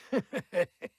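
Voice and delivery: short high wicked cackle; gloating villain cackle; evil cackle. Acted. Villain laughs between lines.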